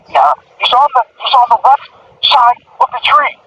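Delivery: high-pitched police radio report; retold radio voice. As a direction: call it in like a cop on the radio. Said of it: "I heard in the radio go".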